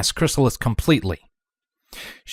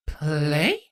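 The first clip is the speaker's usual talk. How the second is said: puzzled foreign word; 3 semitones higher, 4 dB quieter than usual. A narrator's reading. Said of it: "as if it were a foreign phrase".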